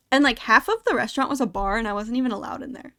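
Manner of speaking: ordinary talk, nothing marked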